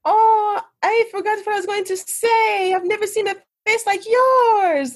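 high-pitched tipsy Swedish lady; Swedish accent. Retold or imitated. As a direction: gush in a hammed-up Swedish accent. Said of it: "try to do a Swedish accent".

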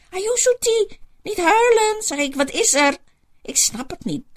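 troostend. Acted. Comforts a crying friend.

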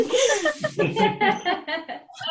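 high burst of real laughter. Real, not acted. Reader laughs at her own slip, real laughter. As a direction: laugh out loud at the stumble.

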